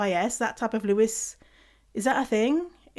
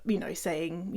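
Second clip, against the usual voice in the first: ordinary delivery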